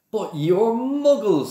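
high, loud, drawn-out amazement; delighted. A narrator's reading. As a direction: marvel aloud, slowly, pitch soaring. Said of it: "said Mister Weasley delightedly".

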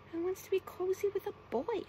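high-pitched scallywag; high storybook scallywag question. A narrator's reading. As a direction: scoff in a high creature voice. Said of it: "asked the scallywag".

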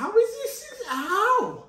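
high-pitched bewildered outburst; baffled disbelief. Real, not acted. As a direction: wail it high, totally baffled. Stunned at friend's success.

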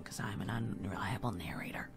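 low whispered aside. Pretend. Let's player slips in a joke on their own narration, whispered aside. Jokey aside during disclaimer.

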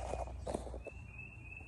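ordinary delivery

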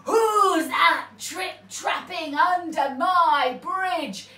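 loud, slow roaring troll. A narrator's reading. Troll roaring at whoever crosses his bridge, booming. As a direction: roar it like a big troll. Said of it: "he roared".

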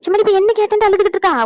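high, loud exasperated question. Acted. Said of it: "கத்திக்கிட்டு கிடக்கா".

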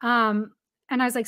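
normal talking voice

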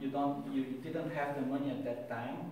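ordinary talk

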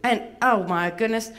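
fussy, vain Southern belle puppet; Miss Bevy. Acted. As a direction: complain in a lilting Atlanta accent. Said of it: "she does have a bit of an accent".

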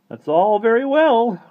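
high, loud, slow grumble; grumbling. A narrator's reading. Mister Turvey eating his slice bitterly, grumbling. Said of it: "grumbled Mister Turvey".

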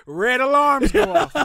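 bursting into laughter at the thought; laughing. Real, not acted. Laughing as he pictures HR alarms going off.

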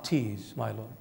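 very soft, low near-murmur; hushed surrender. Acted. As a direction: let the prayer drop to a hush. Mary's plea ends on tears.

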